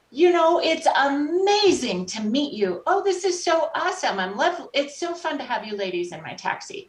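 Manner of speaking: high-pitched imitation of a thrilled taxi driver; gushing delight. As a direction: gush with delight as the retold cabbie. Retold delighted taxi driver's greeting.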